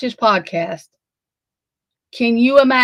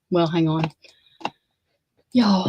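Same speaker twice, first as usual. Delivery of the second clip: normal talking voice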